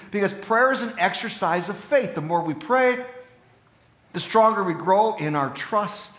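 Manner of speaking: high, loud, fervent preaching; impassioned preaching. Acted. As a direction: build to a passionate exhortation.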